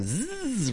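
buzzing fishing-line whizz; drawn-out zipping sound; imitated reel noise. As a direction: buzz like a line running out.

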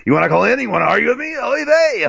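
high, fast radio host call-out; Michael the Wiener Savage call-out. Retold or imitated. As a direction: hype the call-in line like a shock jock. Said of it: "I gotta say it like, uh, Michael the Wiener Savage".

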